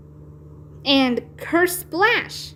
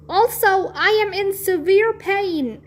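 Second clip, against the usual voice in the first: high pained shout; 7 semitones higher than usual. A narrator's reading. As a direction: cry out about the pain, pitched up. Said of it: "I shouted".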